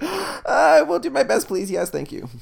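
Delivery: gasped-up, very high eager reply; gushing eagerness. Retold or imitated. Pupil answering Bob Ross's encouragement, gushing eagerness. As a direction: imitate an overwhelmed pupil, starting high and drawn out.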